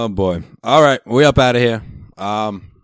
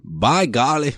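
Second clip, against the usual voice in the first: mock Indiana accent; 7 semitones higher, much slower than usual. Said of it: "That's what they say over there".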